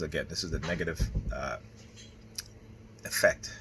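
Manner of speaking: ordinary talk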